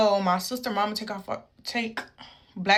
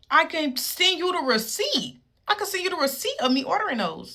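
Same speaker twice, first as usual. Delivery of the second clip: pitched high, pressing the point; a mother insisting she has proof; indignant mom; 8 semitones higher than usual. Retold or imitated.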